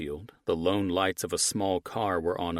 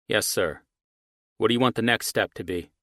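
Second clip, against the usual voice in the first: deferential on phone; 4 semitones higher, 5 dB louder than usual. Acted. Archie on phone to boss.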